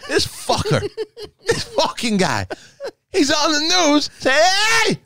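high, slow, laughing incredulous rant; mock rant. Mocking Fauci's news warning to cohost, high and slow. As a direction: stretch it out, high with disbelief.

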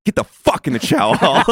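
re-enacted irritated command; retold pissed-off sergeant. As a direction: order someone in, mildly mock furious. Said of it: "He was fucking pissed".

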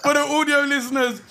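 talking through laughter; laughing. Real, not acted. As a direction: laugh while you speak. Laughing at dancing friend.

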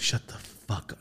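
voiceless, hushed disbelief; hushed shut up. Retold or imitated. Wife reacting to the news, hushed whisper. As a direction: hiss it back in a stunned whisper. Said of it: "She goes".

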